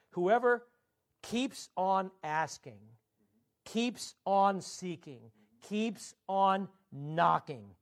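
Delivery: "keeps on" slow and heavy each time; stress on keeps on. Shown as a demonstration. Preacher stressing Jesus' continuous sense to the church, slow and heavy. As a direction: hammer "keeps on asking" slowly. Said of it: "Not whoever does it, whoever keeps on doing it".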